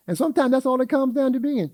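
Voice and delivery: normal talking voice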